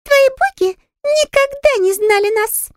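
high, slow scornful pixie voice; snorting pixie. A narrator's reading. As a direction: sneer it as a tiny fairy. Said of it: "фыркнула Пикси".

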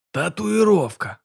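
slow, solemn radio announcer voice; голос диктора Левитана. Acted. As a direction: proclaim it like a wartime Soviet broadcast. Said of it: "провозгласил рассказчик голосом диктора Левитана". (торжественный голос диктора)

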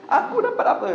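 sudden high whiny complaint; envious wife. Retold or imitated. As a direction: squeal it out like a jealous wife. Istri iri pada tetangga. (rengekan istri yang iri)

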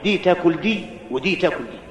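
هادئ وبصوت خافت; calm and quiet. A narrator's reading. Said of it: "بل قال في هدوء".

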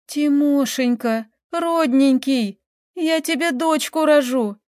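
high, drawn-out lovesick plea; tearful pleading. A narrator's reading. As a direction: beg your beloved, stretching each endearment. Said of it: "Таисия залилась горючими слезами".

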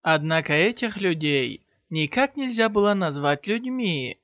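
ordinary delivery, nothing marked

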